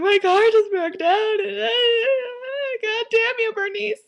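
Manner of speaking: loud, high hammed-up bawling; sobbing. Daughter phoning mom after breakdown, hammed-up sobbing wail. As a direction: wail it through mock sobs, very loud and slow at first. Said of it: "I'm, like, sobbing".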